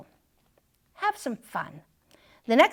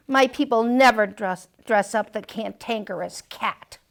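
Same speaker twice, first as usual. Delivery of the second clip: ordinary delivery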